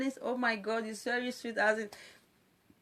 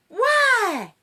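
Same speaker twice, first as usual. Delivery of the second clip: sharp why; 13 semitones higher, 8 dB louder than usual. Real, not acted. Scolding presenters, sharp question.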